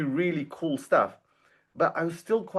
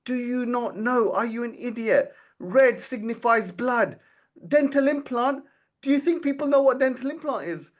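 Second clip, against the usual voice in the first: mock angry scolding; 12 semitones higher than usual. Retelling the dentist yelling down the phone at her, mock furious. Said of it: "he scolded me so badly".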